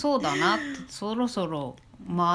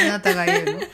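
high, laughing exclamation; laughing protest; 11 semitones higher, 5 dB louder, much faster than usual. Real, not acted. Laughing protest at stolen line.

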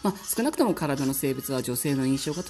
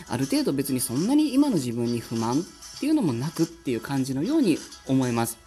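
normal talking voice

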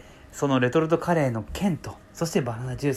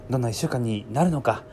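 normal talking voice

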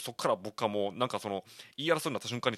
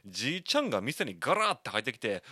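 usual speaking voice

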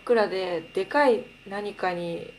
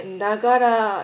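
slow, loud Akita-style んだがら; Akita dialect; 6 dB louder than usual. Shown as a demonstration. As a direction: draw out んだがら like a casual reply. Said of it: "みたいな感じ".

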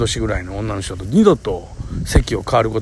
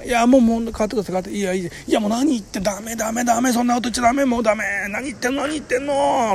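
higher, mock-insistent retelling of the back-and-forth; lightly voiced, fussy no-no-no; insisting refusal; 10 semitones higher than usual. Retold back-and-forth over the seat. (譲り合いを高めの声で軽く再現)

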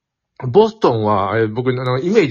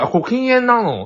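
友達の驚いた質問; 10 semitones higher than usual. Retold or imitated. 東京の友達の台詞を再現.

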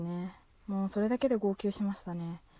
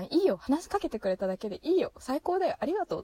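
excited gushing; 6 semitones higher than usual. Real, not acted. Gushing thanks to shy boy.

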